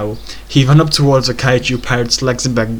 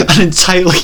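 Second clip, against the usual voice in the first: higher, breaking into laughter; laughing while reading; 6 semitones higher, faster than usual. Real, not acted. Reader reaching the nipple dimension, cracking up. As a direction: try to read on and crack up.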